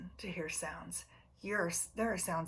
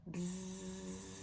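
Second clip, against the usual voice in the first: soft bee buzz; 7 dB quieter than usual. Retold or imitated. Bees in the flowers.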